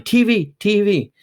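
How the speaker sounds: high excited yell of the name. A narrator's reading. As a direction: shout it out as a thrilled child.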